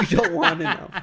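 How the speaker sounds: high, laughing through it. Real, not acted. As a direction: giggle the words out, teasing. Laughing at dirty joke.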